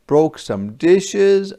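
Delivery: slow, loud and dramatic; dramatic slow emphasis. A narrator's reading. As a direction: land the crash with weight. Dramatic crash moment.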